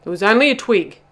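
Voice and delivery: loud, dismissive reassurance; firm assurance. A narrator's reading. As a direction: brush the fear aside loudly. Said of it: "assured Bubba".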